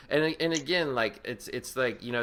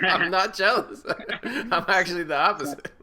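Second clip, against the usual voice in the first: laughing; 7 semitones higher, 5 dB louder than usual. Real, not acted. Laughing off jealousy joke.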